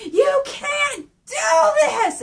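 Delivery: high, drawn-out anguished yell; Raph's enraged scream. Retold or imitated. Raph screaming at Shredder, imitated enraged cry. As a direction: scream it slowly, high and loud. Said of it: "screams at Shredder going like".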